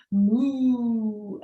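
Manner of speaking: put-on a playful mooing cow for the kids; mock cow moo. Teacher imitating a cow.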